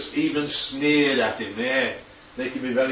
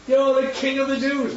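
high, mock-grand taunt; a soldier mocking the prisoner; 10 semitones higher than usual. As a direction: sneer it like a jeering soldier. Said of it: "So they're mocking Jesus".

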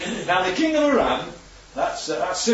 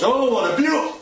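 amazed servant; 7 semitones higher, 3 dB louder than usual. Acted. Servant sees the army outside.

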